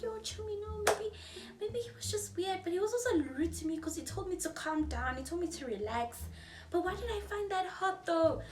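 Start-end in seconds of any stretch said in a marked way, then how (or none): 0.0-6.2 higher, flustered justifying; flustered defending. Retold or imitated. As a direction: make excuses for him, a bit giddy. Herself defending him, flustered.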